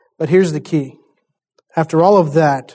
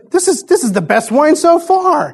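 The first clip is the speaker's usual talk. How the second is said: much higher, gushing over the wine; raving master; 9 semitones higher than usual. Retold or imitated. Master of the feast gushing, pitched up high. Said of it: "He goes".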